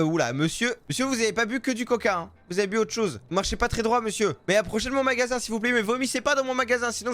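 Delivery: ordinary delivery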